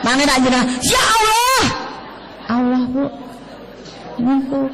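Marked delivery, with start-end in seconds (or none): 0.8-1.6 startled cry. Retold or imitated. Startled by bee sting.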